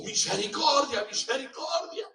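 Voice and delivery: pleading shout, high and loud; desperate cry. Acted. Condemned man begging the crowd before the axe, shouting. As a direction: beg for your life at the top of your voice. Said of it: "empezó a gritar".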